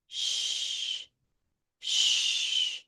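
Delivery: long, unvoiced "Shh"; "Shh" as if telling someone to be quiet; soft, steady stream of air on "Shh"; hushing S H. Shown as a demonstration. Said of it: "like telling someone to be quiet".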